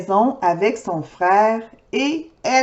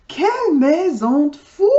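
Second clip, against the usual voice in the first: ordinary delivery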